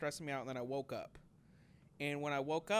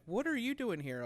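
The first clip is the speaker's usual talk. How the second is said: higher-pitched startled question; retold surprise; 8 semitones higher than usual. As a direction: question a surprise visitor, acting it out. Retold shock at unexpected late visitor.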